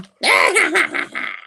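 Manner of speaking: loud, very high acted cackle; goblin cackle. The goblin showing himself to Norman, high mock-evil cackle.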